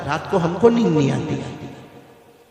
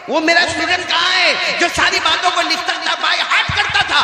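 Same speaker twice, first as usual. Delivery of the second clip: high, loud sermon build-up with laughter; impassioned preacher pitch; fiery preaching; 12 semitones higher, 5 dB louder than usual. Acted. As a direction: rouse the crowd, rising to a cry. Preacher voices teacher's call.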